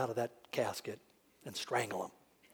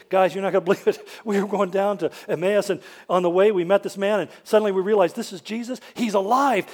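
fast, loud, ending in a shout; excited report; 3 semitones higher, 8 dB louder than usual. Retold or imitated. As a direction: rush in shouting the news. Emmaus disciples rush in excited.

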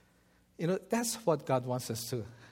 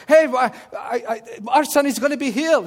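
loud, high, tumbling with joy; excited announcement; 9 semitones higher, 11 dB louder than usual. Retold or imitated. Father rushing out of the shower to tell his wife, overjoyed. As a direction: burst in with the good news.

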